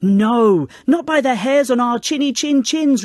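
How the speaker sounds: loud, high chorus-like pig refusal; defiant shout. A narrator's reading. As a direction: shout the wolf away. Pigs refuse the wolf.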